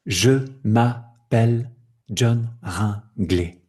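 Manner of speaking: slow "Je m'appelle John Ringley", each syllable set apart. Shown as a demonstration. Teacher demonstrating spoken French, slow syllable by syllable. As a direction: break "Je m'appelle John Ringley" into an evenly spaced chain of syllables. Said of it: "c'est une chaîne de syllabes".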